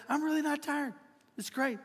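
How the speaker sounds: light, high, softly upbeat retort; breezy insistence. Retold or imitated. Pastor quoting a tireless pregnant wife, breezy insistence. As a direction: imitate her brushing it off, high and light.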